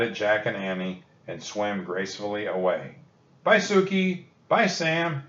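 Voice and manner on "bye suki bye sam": put-on high, bright girlish call to departing dolphins; a light mock shout of farewell; shouted goodbye. A narrator's reading. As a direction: call out after them, higher pitched. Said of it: "Annie shouted".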